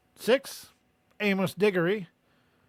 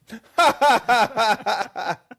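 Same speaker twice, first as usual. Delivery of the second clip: loud, higher-pitched burst of laughter; hearty laugh; 4 semitones higher, 8 dB louder than usual. Real, not acted. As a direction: laugh out loud at your own joke. Laughs at own summary.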